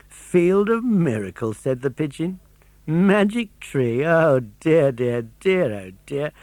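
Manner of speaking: slow, high scornful echo then mock pity; scoffing pigeon. The pigeon scoffing at Pinocchio's magic tree, slow and mocking. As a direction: savour the foolishness, tutting.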